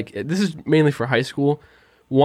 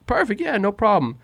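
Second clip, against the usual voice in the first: mock breezy agreement; 7 semitones higher than usual. Feigning ease to the dean.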